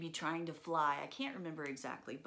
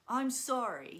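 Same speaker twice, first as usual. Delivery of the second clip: put-on loud, high, grudging ten-year-old; defiant kid apology; 7 semitones higher, 7 dB louder than usual. Boy forced to apologize to his sister, loud defiant tone. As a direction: throw out the apology like a defiant child. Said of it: "in his little defiant ten-year-old way".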